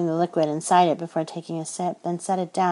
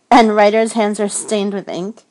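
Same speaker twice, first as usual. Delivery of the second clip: higher, louder playful question; with humor; 4 semitones higher, 9 dB louder than usual. Acted. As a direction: tease the question lightly. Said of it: "with humor in her eyes and voice".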